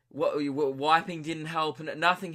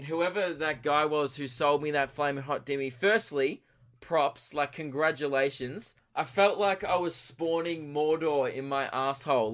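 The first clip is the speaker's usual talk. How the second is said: normal talking voice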